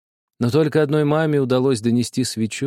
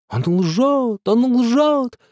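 high shriek, building louder; 11 semitones higher, 4 dB louder, much slower than usual. A narrator's reading. Nadezhda shrieks at father that the boy lies. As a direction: screech the accusation. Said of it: "взвизгнула Надежда".

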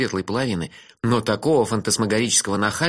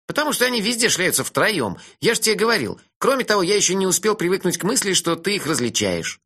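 put-on cheeky dreamy young man, higher; teasing friend, pitched up; higher voice for Melifaro; 9 semitones higher than usual. (насмешливый голос Мелифары повыше)

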